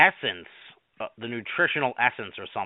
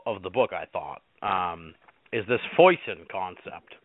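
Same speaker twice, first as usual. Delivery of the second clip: normal talking voice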